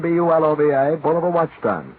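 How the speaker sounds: usual speaking voice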